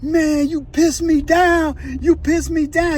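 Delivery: loud, high, drawn-out protest; retold outraged yell. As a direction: imitate a slow, high yell of disbelief. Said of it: "Ghost Rider's like yelling".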